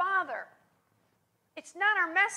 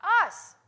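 a loud, higher punch on "Us"; emphatic answer; 4 semitones higher, 6 dB louder than usual. Shown as a demonstration. Preacher answers his own question about the prayer's emphasis, punching the word. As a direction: hit "Us" hard after the pause. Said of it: "where is the emphasis in this prayer?".

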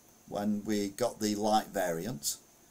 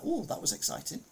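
mock enthusiasm; 10 semitones higher than usual. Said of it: "And you're like".

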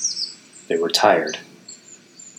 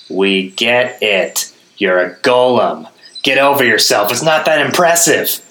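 mock him, louder each line; rising taunt; 5 semitones higher, 3 dB louder than usual. A narrator's reading. Gaz mocking the golem.

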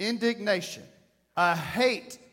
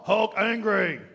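mock monster voice, angry; hammed-up angry brute voice; Hulk; 4 dB louder, slower than usual. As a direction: grunt it out like an enraged comic-book brute. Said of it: "Hulk, what's wrong?".

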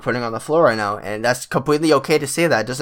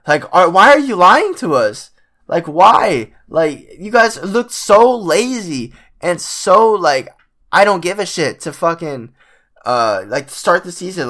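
raised, louder exasperated rant at the team; frustrated rant; 6 semitones higher, 4 dB louder, slower than usual. Real, not acted. Fan venting at the Clippers for playing badly, exasperated loud rant. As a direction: let the frustration boil over, loud and high.

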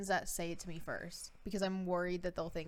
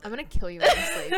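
mock threat; 7 semitones higher, 12 dB louder than usual.

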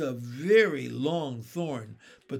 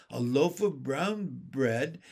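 ordinary delivery

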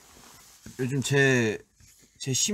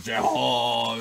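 진영, lightly imitated; 3 dB louder, much slower than usual. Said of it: "이러면서".

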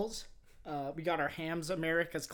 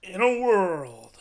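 loud, slow booming trailer announcer; projected trailer voice; 11 dB louder, much slower than usual. Shown as a demonstration. Host told to project more, booming trailer voice. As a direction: project it, stretched right out. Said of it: "Project more".

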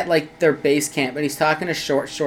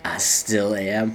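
very low, slow, softer tough-guy line; menacing Danton; 9 semitones lower, 7 dB quieter, much slower than usual. Retold or imitated. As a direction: draw it out deep and quiet, like a deadly hero. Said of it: "Then he goes".

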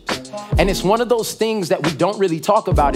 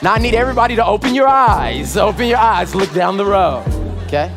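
booming motivational speech; 5 semitones higher than usual. Acted. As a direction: pump up the congregation. Hypes crowd, asks for noise.

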